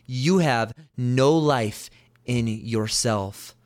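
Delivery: "you have no life in yourself" spoken very slowly, each word weighed; slow down and let each word sink in; slow weighty warning. Shown as a demonstration. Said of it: "dial in here even more".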